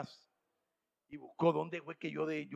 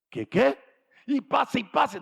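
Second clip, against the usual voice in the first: higher and louder mock panic; panicked disbelief; 9 semitones higher, 7 dB louder, slower than usual. Preacher voicing the startled man, higher and louder. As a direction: sell the shock of empty pages.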